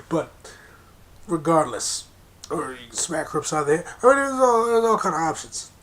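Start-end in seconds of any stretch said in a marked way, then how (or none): 4.0-5.7 higher and louder, worked up; heated burst. Real, not acted. Listing options to listeners, loud heated burst. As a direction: blurt it out, fired up.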